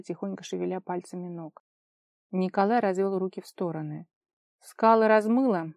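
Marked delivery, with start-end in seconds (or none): none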